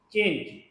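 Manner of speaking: चेंज said as "change", with a ch sound at the start; reading the word aloud. Shown as a demonstration. Teacher reading the spelt word to students, clearly. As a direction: read चेंज clearly as one word, ch not c-h. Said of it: "अब जैसे ये word लिखा है सीएचएएनजीई".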